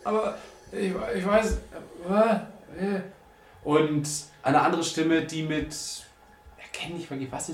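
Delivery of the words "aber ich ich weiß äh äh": halting, higher-pitched stammer; Nalu. Acted. Game master voices a captive overheard through the door, stammering. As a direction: stumble over the words, groping for an answer. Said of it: "die Stimme von Nalu, der aber nur so".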